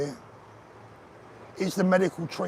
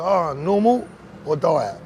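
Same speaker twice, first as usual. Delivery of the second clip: imitating the waiter; 6 dB louder, slower than usual. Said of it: "They go".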